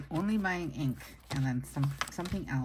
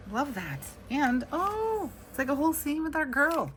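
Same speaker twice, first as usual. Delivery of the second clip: much higher, louder squeal of delight; 12 semitones higher, 4 dB louder than usual. Real, not acted. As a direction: gush over something adorable. Delighted at the sticker scene.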